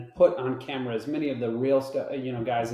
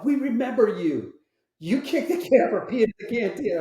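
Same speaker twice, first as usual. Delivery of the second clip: teasing wardrobe people; 9 semitones higher, 4 dB louder than usual. Retold or imitated. Wardrobe people teasing, voiced high.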